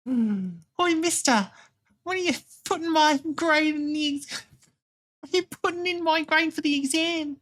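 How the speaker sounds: very high put-on Cockney voice; Cockney chimney sweep. Pupil asking mister about an exam grade, high Cockney voice. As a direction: whine it high like a Cockney kid. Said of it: "chimney sweep, like, Cockney type voice".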